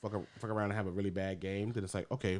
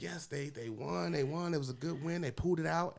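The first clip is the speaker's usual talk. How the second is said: imitating her mom; 8 semitones higher than usual. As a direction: play the mom, pleased. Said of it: "she's like".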